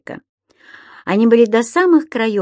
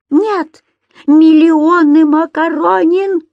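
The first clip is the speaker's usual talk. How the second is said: high, loud, drawn-out outburst; storming landlady at full volume; raging; 8 semitones higher, 7 dB louder, slower than usual. A narrator's reading. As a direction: bellow the exaggeration slowly, furious. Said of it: "бушевала хозяйка".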